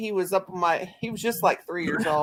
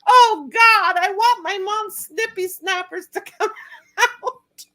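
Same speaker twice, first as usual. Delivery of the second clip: put-on high, loud, slow wailing child through laughter; mock crying toddler; 13 semitones higher, 5 dB louder, slower than usual. Toddler sobbing for grandma's teeth, mock wail. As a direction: wail like a heartbroken little kid. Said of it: "he started crying his eyes out".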